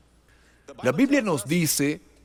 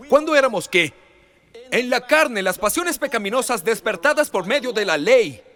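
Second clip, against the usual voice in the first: higher, louder exhortation; fervent preaching; 5 semitones higher, 4 dB louder than usual. Acted. Pastor exhorting the congregation, loud and high. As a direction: urge the church to enjoy the Lord. Preaching climax, scripture read.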